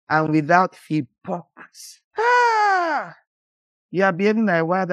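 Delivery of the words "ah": high exclamation of dismay; sharp high cry. Real, not acted. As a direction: yelp in exasperation. Preacher exclamation.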